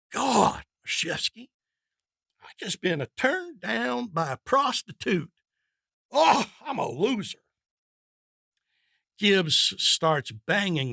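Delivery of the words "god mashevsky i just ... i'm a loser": despairing wail. Acted. Gibbs laments rejection.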